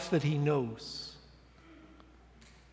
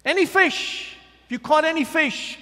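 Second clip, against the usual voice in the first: higher and louder call across the water; 10 semitones higher, 8 dB louder than usual. Acted. Stranger on the shore calling to the fishermen, loud and high. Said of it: "cried the stranger".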